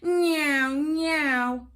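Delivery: loud, high neeow like a toy plane; aeroplane noise. Acted. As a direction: zoom it like a child playing aeroplane. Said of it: "went Baby Bear".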